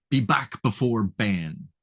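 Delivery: "Be back before Ben" slow, every B hit hard; heavy B sounds. Shown as a demonstration. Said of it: "that heavy B being alliterated".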